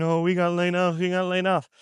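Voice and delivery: whiny fake-sad lament; mock crying whine. C-suite bosses laid off, mock crying whine. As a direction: ham up a mocking sob story. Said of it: "they're gonna be crying and saying".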